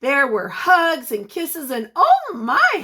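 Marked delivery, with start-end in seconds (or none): 1.9-2.8 high, loud, delighted "Oh my"; a sing-song relative's exclamation. Acted. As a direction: gush over the grown-up kids. Relatives greeting the children.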